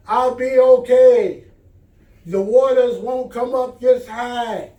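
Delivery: calling back. Acted. Man waves off the pilot.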